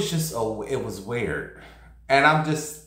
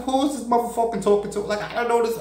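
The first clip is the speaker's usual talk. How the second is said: high, fast indignant shriek; who does he think he's talking to; outraged disbelief; 12 semitones higher, faster than usual. Retold or imitated. Stranger threatening her on street.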